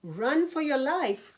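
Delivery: high, urgent yell to flee. A narrator's reading. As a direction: shout them into running. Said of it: "yelled Rabbit".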